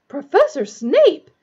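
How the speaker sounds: high, loud, drawn-out cry of outrage; scandalized shriek. A narrator's reading. Matron scolding the raging professor, shrieking. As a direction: shriek the name in slow reproach. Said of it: "shrieked Madame Pomfrey".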